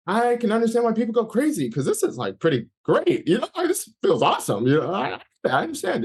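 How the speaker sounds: high, swooping mock-delighted realisation; giddy amazement. Man talking to himself while feeling crazy, retold giddy amazement. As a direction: marvel at it, pitch leaping up high. Retelling giddy thoughts during uncontrollable laughter.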